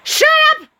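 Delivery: sudden loud snap; shouting. Acted. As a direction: yell it to cut him off. Dex snaps on phone.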